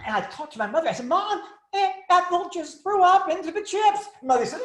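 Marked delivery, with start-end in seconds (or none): 1.1-4.1 high, urgent whisper-shout to mom; alarmed report. Retold or imitated. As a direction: blurt the news to your mother in alarm. Telling mother aunt vomited.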